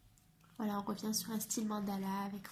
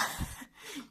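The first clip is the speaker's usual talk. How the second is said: soft laugh; 8 semitones higher, 4 dB quieter than usual. Real, not acted. Laughs about her favourite drawing.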